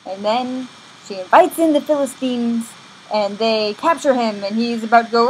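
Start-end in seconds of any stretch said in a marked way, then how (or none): none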